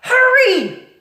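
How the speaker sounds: very high, loud yell; shouted. Acted. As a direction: scream it. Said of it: "shouted Cook".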